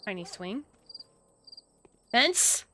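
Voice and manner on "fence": higher, eager shouts of fence; excited calls. Real, not acted. The player spotting a fence in the game, excited. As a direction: call it out as you spot it.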